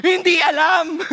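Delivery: laughing mockery of the words; mocking laugh. Crowd mocking the beaten preacher, laughing. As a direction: laugh while mocking him. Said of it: "retelling the crowd's mockery".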